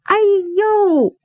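high drawn-out wail of dismay; dismayed cry. Acted. Woman sees her precious carpet trampled, dismayed cry. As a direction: cry out as your treasure is wrecked.

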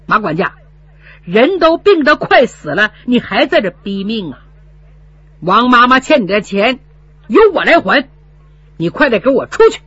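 loud furious order to leave. Acted. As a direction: throw him out with blazing eyes. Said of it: "两眼冒火". (两眼冒火，怒声赶人)

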